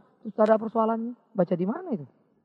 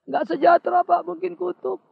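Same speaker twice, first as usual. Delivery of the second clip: higher, whiny imitation of a sick believer; whiny complainer; 6 semitones higher, 5 dB louder, slower than usual. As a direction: whine that it must be a curse. Congregant blames a curse.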